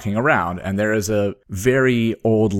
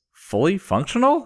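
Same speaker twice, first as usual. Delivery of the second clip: questioning doctor; 11 semitones higher than usual. Retold or imitated. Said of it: "One says".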